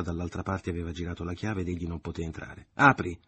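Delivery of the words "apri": very loud, enraged; furious shout. A narrator's reading. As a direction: pound the door and yell. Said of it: "gridò Alfine al colmo della rabbia". (urlo furibondo)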